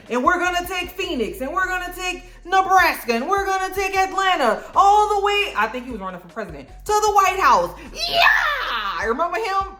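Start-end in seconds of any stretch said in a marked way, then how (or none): 0.1-5.5 high, rising rally speech; high-pitched campaign yell; politician impression. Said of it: "he was like". 6.7-7.7 high, rising rally speech; high-pitched campaign yell; politician impression. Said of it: "he was like". 7.9-9.0 high laughing scream of joy; shrill cheering shout; triumphant yell. Retold or imitated. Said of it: "That's what I did".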